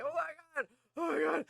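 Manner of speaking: high-pitched frantic exclamations; mock panic. Player running outside from the ghost, high mock panic. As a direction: re-enact fleeing in terror, voice shooting up.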